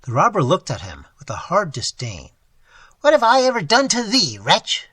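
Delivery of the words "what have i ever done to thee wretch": higher, louder grieving cry; anguished cry. Acted. Old man crying out to his ungrateful ward. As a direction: cry out in hurt. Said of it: "cried the old man".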